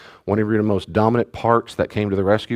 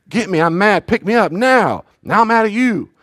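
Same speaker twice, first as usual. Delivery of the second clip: put-on high, louder cranky toddler demands; angry toddler; 12 semitones higher, 4 dB louder than usual. A tired baby yelling at his dad, high and cranky. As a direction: whine it like a furious overtired baby. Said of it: "he's sad".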